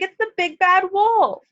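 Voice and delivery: high, alarmed cry about the wolf; panic, like a little pig spotting danger; frightened piglet. Acted. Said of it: "cried the little pig".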